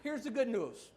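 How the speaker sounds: ordinary talk, nothing marked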